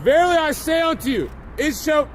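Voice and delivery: ordinary talk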